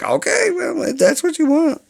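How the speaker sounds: a shrugging mock falsetto, giving the viewers what they want; mock falsetto shrug. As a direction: toss it off in a squeaky fake voice. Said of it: "And I'll be like".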